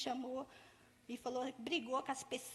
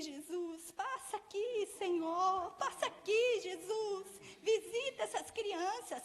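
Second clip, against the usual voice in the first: higher, louder crying out to God; fervent prayer; 5 semitones higher, 4 dB louder, slower than usual. Retold or imitated. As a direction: plead with the Lord, building, slow. (clamor em oração, mais alto e agudo)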